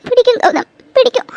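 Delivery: high, loud tearful yes; rising emotional cry of yes; emotional outburst. Acted. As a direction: blurt it out through tears.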